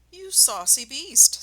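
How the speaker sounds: Mister Fox voice. Acted. Mister Fox teasing Rat in the cellar. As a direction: savor the insult, amused. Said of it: "said Mister Fox".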